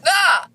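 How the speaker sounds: high squeaky hiccup; real hiccup yelp; loud hiccup. Real, not acted. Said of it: "These are my legit hiccups".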